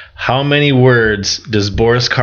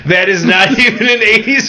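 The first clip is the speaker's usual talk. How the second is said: laughing; 12 semitones higher, 3 dB louder than usual. Real, not acted. Laughing at the wrong answer.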